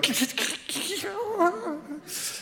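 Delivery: high, far-off cackling laugh of friends; background friends' laugh. Retold or imitated. Friends laughing in the background of the call, imitated high cackle. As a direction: imitate the friends giggling behind her. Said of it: "people in the background going".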